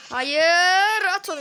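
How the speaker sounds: loud high Hayır, then urging; frantic urging shout; desperate shout. Real, not acted. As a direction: yell at the screen to shoot.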